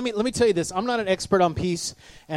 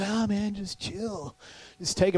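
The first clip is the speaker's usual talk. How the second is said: soft, fading easygoing drawl; laid-back brush-off; 5 semitones higher, 6 dB quieter, slower than usual. Retold or imitated. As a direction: wave off the hurry, mock mellow. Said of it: "And we're going".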